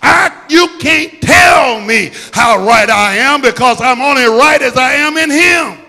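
loud, driving sermon voice; full-force preaching. Acted. Preacher rallying the congregation, preaching full force. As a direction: preach it hard over the music.

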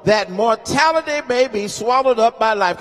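sermon cadence, rising and intense; preaching. Acted. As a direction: lift the scripture like a preacher at the pulpit. Preaching scripture at full force.

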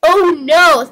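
high, loud cry of alarm; boy yelling in dismay in a joke; yelled. Acted. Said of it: "He yelled".

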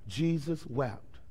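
slow, very soft and low; hushed. Acted. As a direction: let it fall almost silent. Reading the shortest verse.

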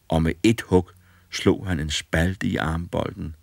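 usual speaking voice

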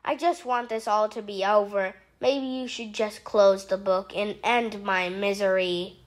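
low, soft, fast hopeless sigh; low mumbled misery; despairing. Acted. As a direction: give up in gloom. Despairing after ruin.

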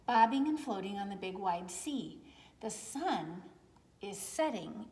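gentle storybook narration, lots of tune; reading to children. A narrator's reading. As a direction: read it like bedtime to little ones. Reading a picture book aloud.

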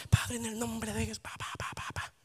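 very soft, low murmur fading into nonsense syllables; mock mumbled prayer. Believer praying privately for family, mock mumbled prayer. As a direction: mimic rote praying under the breath, dying to a whisper.

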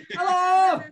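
loud, high hello from afar; calling out. Retold or imitated. Three tipsy women shouting to the runner, loud high hello. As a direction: holler a greeting up the hill.

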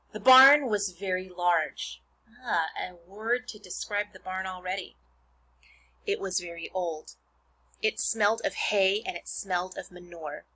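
put-on expressive read-aloud to children; reading a book to a class; story narration. Reads Charlotte's Web aloud.

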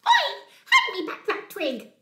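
high, loud little-creature voice; demanding millipede. Acted. Tiny millipede confronts Bear over twig, high demand. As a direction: demand it back indignantly. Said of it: "demanded a teeny tiny millipede".

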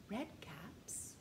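breathy secret whisper. Acted. Teacher whispering the count to the children, hushed. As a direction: whisper red caps softly.

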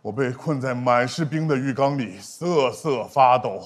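low, slow, soft storytelling; low thriller narrator; suspense narration. Acted. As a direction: narrate the horror tale gravely, slowly.

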